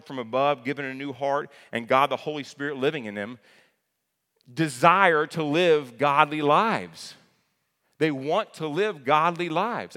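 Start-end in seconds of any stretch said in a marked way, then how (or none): 4.5-10.0 loud, slow, higher preaching; "desire" slowed and hammered out. Acted. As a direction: drive it home from the pulpit. Preaching at full force.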